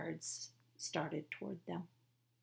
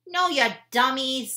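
indignant shout; 7 semitones higher, 12 dB louder than usual. Acted. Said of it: "said Annie".

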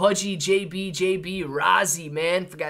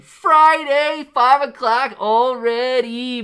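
high, loud, stretched out; excited cry; 10 semitones higher, 10 dB louder, much slower than usual. Real, not acted. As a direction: cheer the weekend in. End of stream excitement.

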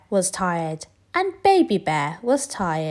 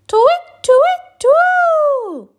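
very high, louder tweets; tweeting; 11 dB louder, much slower than usual. Acted. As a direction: chirp like a bird. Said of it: "went the owl".

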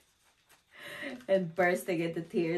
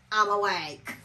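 mock alert protest; 3 semitones higher, 5 dB louder than usual. Retold answer to team leader.

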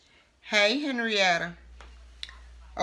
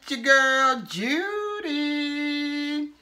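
high, loud, sing-song catchphrase hello; upbeat cooking-show opener, higher and louder; show intro greeting; 6 semitones higher, 3 dB louder, much slower than usual. Acted. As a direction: greet the viewers like a TV host opening her show.

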